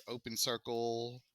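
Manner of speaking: normal talking voice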